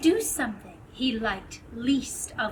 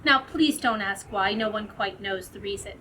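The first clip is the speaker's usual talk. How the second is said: plain reading voice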